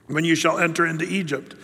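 normal talking voice

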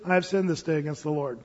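low, soft, simple admission; acted quiet repentance, low-voiced; understated confession. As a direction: own up quietly without display. David's plain confession set against Saul's.

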